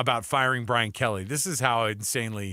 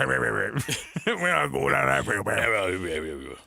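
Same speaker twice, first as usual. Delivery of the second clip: very slow Louisiana bayou voice; Bobby Boucher; 2 semitones lower, much slower than usual. Retold or imitated. Host mocking the governor's quote, very slow Louisiana voice. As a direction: stretch each word out slow like a bayou boy. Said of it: "It sounded Bobby Boucher".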